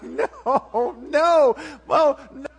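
loud, high panicked "no"; a kid dreading punishment, shouting no; panicked child. Retold or imitated. Child dreading dad coming home.

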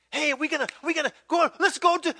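high, loud, excited pestering; eager invitation. Acted. Friend urging him to come along, excited.

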